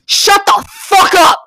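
mother snapping, yelling loud and slow; yelled. Retold or imitated. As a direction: bellow it right in his face. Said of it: "looks him in the face and goes".

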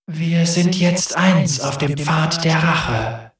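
slow, cold ghostly warrior; grave-cold spirit voice. Acted. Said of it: "Ihre Stimme war grabeskalt".

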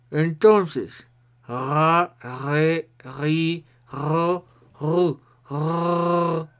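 French R with a pencil between the teeth. Shown as a demonstration. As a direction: talk around a pencil held in the teeth. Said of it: "voy a proceder a ponérmelo en la boca y voy a pronunciar".

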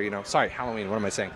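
usual speaking voice

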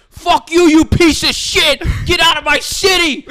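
high, furious screaming at a player; angry Philly fans yelling. Retold or imitated. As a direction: scream it like a hostile crowd. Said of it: "they're like".

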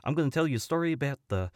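lilting, friendly rock-creature introduction; Korg. Acted. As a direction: slip into character as a chatty pile of rocks. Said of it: "could you please do that the entire podcast".

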